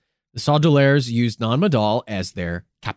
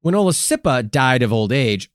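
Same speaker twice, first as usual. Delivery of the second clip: normal talking voice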